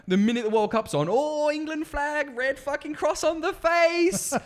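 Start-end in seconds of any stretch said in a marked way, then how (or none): 1.1-4.3 very high, drawn-out mocking cheer; hammed-up football fan, stretched right out; mock fan zeal. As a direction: ridicule the fans in a high, slow whoop. Mimicking World Cup fans.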